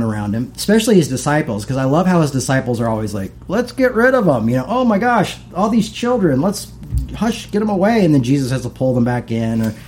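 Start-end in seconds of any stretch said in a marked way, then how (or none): 3.5-4.5 high-pitched mock urging; imitating dismissive disciples. Disciples urging Jesus to send people off, mock dismissive. 4.7-8.0 high-pitched mock exasperation; imitating exasperated disciples. Disciples shooing children away from Jesus, mock exasperated.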